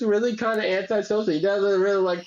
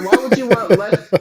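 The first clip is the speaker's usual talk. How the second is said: incredulous outburst; 3 semitones higher, 9 dB louder than usual. Real, not acted. Ranting about the name lesser.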